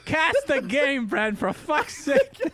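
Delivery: high, helpless laughter through words; laughing so hard the voice squeaks. Real, not acted. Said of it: "You're making me laugh".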